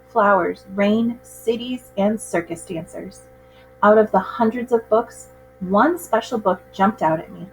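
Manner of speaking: plain reading voice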